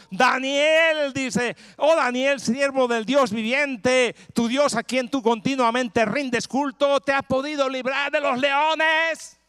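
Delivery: high, loud anguished calling out; desperate king calling into the lions' den; sad shout. Acted. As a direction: cry out the name in grief. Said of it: "gritó con tono entristecido".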